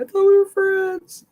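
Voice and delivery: squeaky hurt falsetto whine; high whiny voice. Retold or imitated. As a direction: squeal it like a betrayed kid. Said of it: "get that sound bite".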